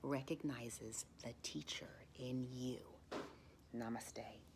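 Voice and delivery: hushed closing words; whispered farewell. Acted. Teacher bidding students goodbye, soft whisper. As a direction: send them off gently in a whisper.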